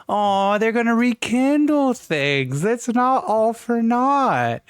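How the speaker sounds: airy hopeful falsetto; high, slow, dreamy. Retold or imitated. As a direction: draw it out high and wistful. Voicing hopeful inner thought.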